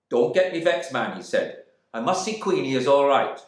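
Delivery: high, loud demand; challenging stranger. Acted. Gilbert challenging the man at the door, loud. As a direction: square up to the stranger. Stranger insists on seeing Queenie.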